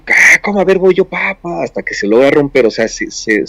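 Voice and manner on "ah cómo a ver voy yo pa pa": eager neighbor. Retold or imitated. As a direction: play the cocky neighbor grabbing the wrench. Neighbor's turn to break glass.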